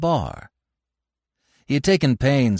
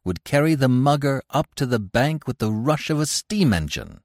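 plain reading voice

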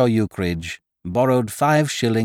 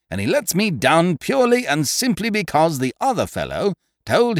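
higher, indignant tirade; vigorous indignation; 7 semitones higher than usual. Acted. Eucridge ranting about his boxer's softness. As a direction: rant with wounded vigour. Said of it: "throwing off his lethargy with a vigour that spoke of mental anguish".

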